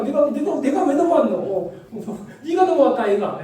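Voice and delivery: high, loud Gyeongsang accusation; girl insisting he crossed the line; angry Gyeongsang girl. Retold or imitated. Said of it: "가시나가".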